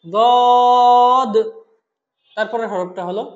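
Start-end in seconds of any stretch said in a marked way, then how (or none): 0.1-1.3 দদ loud, long and level; elongated letter. Shown as a demonstration. Teacher models for repetition, দদ drawn out loudly. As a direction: chant দদ strongly, holding the vowel. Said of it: "বলুন আমার সাথে".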